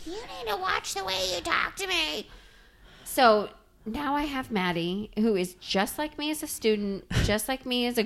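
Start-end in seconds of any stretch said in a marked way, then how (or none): 0.1-2.3 high, soft mock scolding mom; a hint of a scolding mother. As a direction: lightly voice a stern mom's warning. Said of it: "she'd be like".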